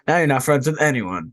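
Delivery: ordinary talk, nothing marked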